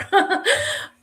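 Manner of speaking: high teary laugh breaking into a cry; tearful laugh. Real, not acted. Survivor laughing through tears about her friend. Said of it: "gain composure here".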